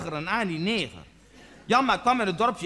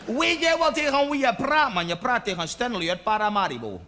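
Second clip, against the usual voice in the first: badly faked Surinamese accent. Stanley challenging Janmaat, in a shaky attempt at a Surinamese accent. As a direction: put on an unconvincing Surinamese accent. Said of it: "een accent is nooit mijn sterke kant".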